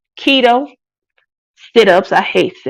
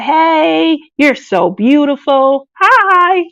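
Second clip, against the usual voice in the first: flirty pickup line; 5 semitones higher, much slower than usual. Pretend. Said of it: "and be like".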